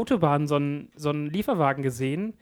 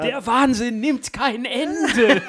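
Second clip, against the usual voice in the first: laughing; 7 semitones higher, 5 dB louder, much slower than usual. Real, not acted. Mock outrage at news.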